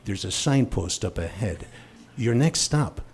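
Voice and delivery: low, soft ominous TV narrator; Rod Serling voice. Pretend. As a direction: intone it like the Twilight Zone host. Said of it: "fell in love with voices".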